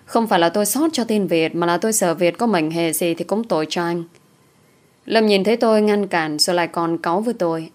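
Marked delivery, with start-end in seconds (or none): none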